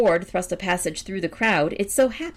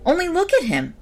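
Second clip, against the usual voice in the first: raised pitch, climbing on the repeat; excited exclamation; 8 semitones higher, 3 dB louder than usual. A narrator's reading. Man to his next neighbor about the stranger, excited exclamation. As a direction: call it out in mounting amazement.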